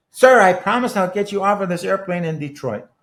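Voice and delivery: a mock overdone firm vow; firm promise. Attendant firmly promises.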